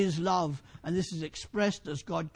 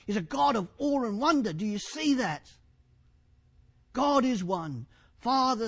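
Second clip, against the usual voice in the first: raised, emphatic sermon voice; fervent preaching; 6 semitones higher, 5 dB louder than usual. Real, not acted. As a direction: preach it with conviction, punching key words. Preaches with rising fervour.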